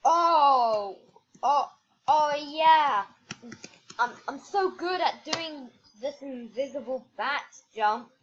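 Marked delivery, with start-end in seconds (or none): none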